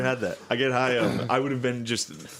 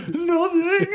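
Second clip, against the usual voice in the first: very high, louder squeal; mock cowed whimper; 5 dB louder than usual. Son backing down from angry dad, mock cowed whimper. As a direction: cave instantly in a laughing squeak.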